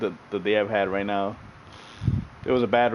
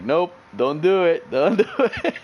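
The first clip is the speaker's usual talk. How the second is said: stifling laughter; 6 semitones higher, 3 dB louder than usual. Retold or imitated. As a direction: hold the laugh in and fail. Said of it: "biting your lip not to laugh".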